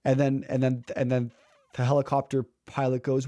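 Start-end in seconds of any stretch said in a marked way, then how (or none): none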